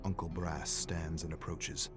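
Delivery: ordinary delivery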